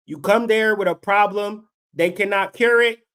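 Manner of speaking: ordinary delivery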